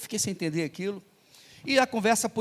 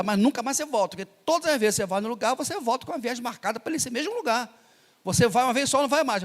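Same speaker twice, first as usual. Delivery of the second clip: insistent wife; 6 semitones higher than usual. Retold or imitated. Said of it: "a esposa preocupada".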